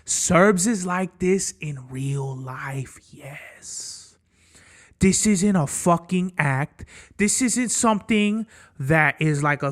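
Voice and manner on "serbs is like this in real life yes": slow, unvoiced whisper; a hushed, slow confiding whisper. Real, not acted. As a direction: lean in and whisper it slowly like a secret. Answering doubters about his talk.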